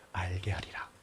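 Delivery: whispered close of scripture; hushed solemn ending. Acted. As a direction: let God's promise fade to a whisper. Scripture quote fading.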